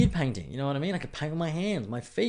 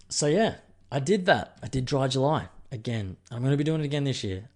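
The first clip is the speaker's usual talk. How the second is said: normal talking voice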